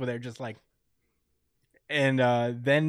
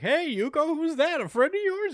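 much higher, cheery neighbor voice; chirpy neighbor. Retold or imitated. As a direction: greet her like a cheery old neighbor.

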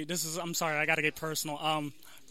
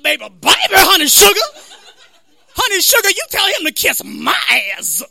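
loud, high, over-the-top caricature; exaggerated sassy woman; 7 semitones higher, 8 dB louder than usual. DMV counter woman in a friend's story, loud hammed-up caricature. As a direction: holler it in bursts, piling on endearments. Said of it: "there's this woman at the counter".

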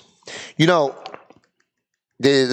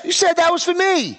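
high, incredulous protest; indignant protest. Real, not acted. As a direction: protest like you've been wronged. Protesting the book was a gift.

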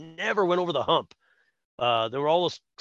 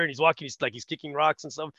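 ordinary talk

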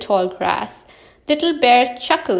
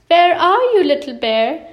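raised, louder searching call; 6 semitones higher, 4 dB louder than usual. A narrator's reading. Big Bear seeking his brother, calling out. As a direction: call out high, then ease down.

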